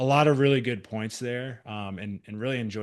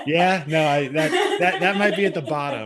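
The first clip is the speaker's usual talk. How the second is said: laughing; 5 semitones higher, 8 dB louder than usual. Real, not acted. Host laughing at own joke.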